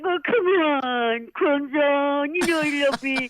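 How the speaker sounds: endearing coaxing. Retold or imitated. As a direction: charm her into forgiving, drawn out. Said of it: "he was really endearing".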